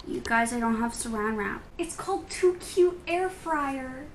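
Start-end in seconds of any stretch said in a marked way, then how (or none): 1.8-4.1 higher, slowed-down naming; cutesy announcement. Real, not acted. Showing viewers the fryer's name, high slow delivery. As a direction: coo the product name, drawn out.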